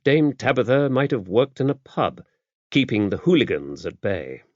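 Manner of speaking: ordinary delivery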